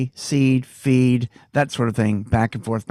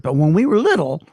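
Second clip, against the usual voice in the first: plain reading voice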